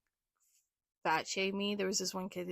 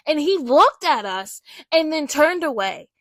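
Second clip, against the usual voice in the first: ordinary talk, nothing marked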